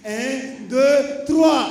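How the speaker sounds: rising count ending in a shout; building count to shout. Real, not acted. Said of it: "Je vais compter jusqu'à trois".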